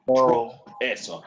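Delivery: ordinary talk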